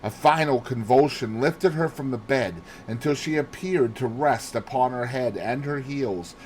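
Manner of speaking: usual speaking voice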